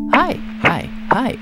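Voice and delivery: high squeaky little hi; tiny cartoon rat greeting. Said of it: "was it just like a".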